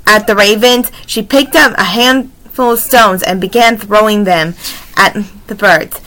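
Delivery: usual speaking voice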